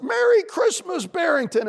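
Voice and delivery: high, loud holiday holler; calling down cheerily. A narrator's reading. Squirrel greets the bunny below, calling down. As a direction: sing out the greeting from the treetop.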